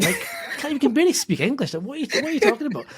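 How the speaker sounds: high, fast scoffing voice; a quick, high-pitched jeer as the doubters mock Arnie. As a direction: sneer at the dreamer in a high squeak. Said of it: "And everyone's like".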